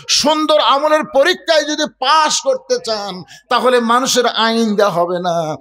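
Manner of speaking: high-pitched, ringing sermon delivery; fervent preacher's exhortation; impassioned preaching. Real, not acted. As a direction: press the point home to the crowd.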